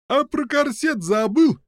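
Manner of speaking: high, taunting yell. A narrator's reading. Prisoner distracting the giant, high taunting yell. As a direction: yell a jeer at full pitch. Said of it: "из всей мочи крикнула следующая камера".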